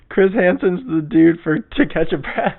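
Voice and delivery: real laughter breaking into the words; laughing. Real, not acted. Podcaster cracking up at their own name mix-up, laughing.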